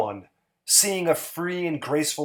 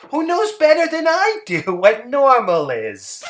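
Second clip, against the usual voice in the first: higher, sweet and simple housewife voice; Hazel's voice; 10 semitones higher, 5 dB louder than usual. Acted. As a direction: voice Hazel a bit higher, artless and pleased. Hazel's voice in story.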